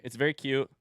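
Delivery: ordinary delivery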